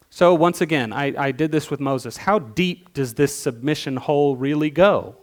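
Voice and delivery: ordinary delivery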